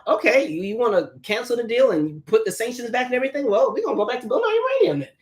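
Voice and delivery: high, fast, taunting voice for Iran; a cocky, mocking rival answering; mock Iran reply. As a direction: sneer it back, quick and high. Voicing Iran's defiant reply.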